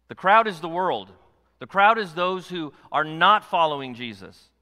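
loud, high preaching climax; full-force preaching. Acted. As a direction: preach it hard to the church.

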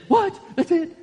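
a viewer stunned by a cliffhanger ending; shocked disbelief. Retold or imitated. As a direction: squeak it out in disbelief. Reacting to a season finale.

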